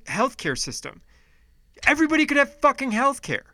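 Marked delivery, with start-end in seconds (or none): 1.9-3.4 real anger, pitched up and fast; getting mad. Real, not acted. Podcaster ranting to listeners about healthcare, angry and rising. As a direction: let the frustration boil over into a quick, high outburst. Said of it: "All right, I'm getting mad".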